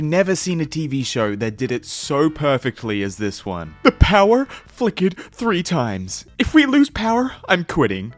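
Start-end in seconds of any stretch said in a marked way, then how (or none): 3.8-8.1 high, loud rising panic; worried the power cuts out; nervous panic. Real, not acted.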